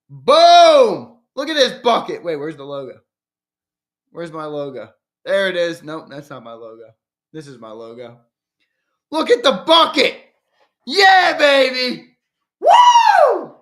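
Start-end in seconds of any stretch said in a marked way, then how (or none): 0.1-2.2 big loud high "Boom!"; excited shout. Real, not acted. Streamer showing off his new bucket hat, shouting with excitement. 9.1-13.6 high, loud, hyped-up yells; excited yelling. Real, not acted. Streamer showing chat his Johnson bucket hat, loud excited yelling.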